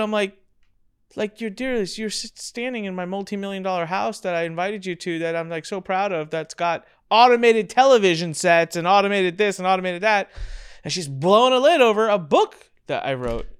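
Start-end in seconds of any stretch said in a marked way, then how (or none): 1.4-10.3 exasperated. Retold or imitated. Exasperated reply to cousin.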